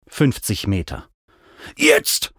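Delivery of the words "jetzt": high, explosive yell; shout. A narrator's reading. Mount shouts on a powerful wingbeat, straining shout. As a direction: yell it out high with sudden force. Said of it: "schrie Mac".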